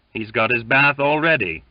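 a touch of grim seadog remark; grim shellback. A narrator's reading. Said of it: "remarked a grim shellback".

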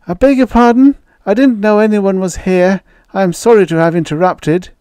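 put-on high, carefully precise young boy's voice; a polite boy pronouncing every syllable; painfully precise boy. A narrator's reading. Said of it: "His enunciation was almost painfully precise".

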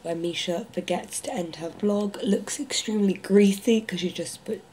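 whispering. Real, not acted. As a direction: whisper it late at night. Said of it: "has a lisp because I've put my retainer in".

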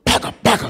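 loud sharp call; nail gun shout. Retold or imitated. Dad working the nail gun, shouting loudly.

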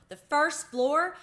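high, loud and slow disbelieving question; can't believe his ears; astonished. A narrator's reading. Keith amazed at mouse's trip.

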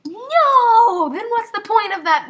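high, loud wail of protest; exasperated outcry. Real, not acted. Player finds the secret code useless, exasperated shout. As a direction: cry out no, then complain loudly.

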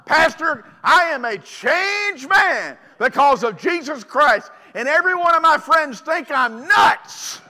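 loud, ringing hey, hey; joyful declaration. Retold or imitated. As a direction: shout the change like a revival. Convert declares change, shouting.

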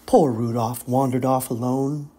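usual speaking voice

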